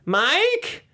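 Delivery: high, hesitant calling out; timid calling. Retold or imitated. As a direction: call out nervously. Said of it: "she's like".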